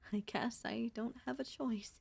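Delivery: quiet, defeated whisper; resigned whisper. Acted. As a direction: let it slip out as a defeated whisper. Repeated line, whispered.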